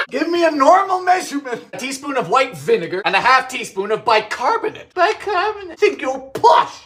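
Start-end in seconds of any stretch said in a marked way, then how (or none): 0.1-1.6 mock exasperated. Annoyed at old recipe units. 5.0-6.7 mocking. Mocking the recipe's word.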